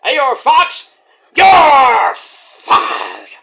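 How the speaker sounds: loud hammed-up boss shout; Vince McMahon impression. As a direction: bellow the dismissal like a wrestling boss. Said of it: "does his best Vince McMahon impression".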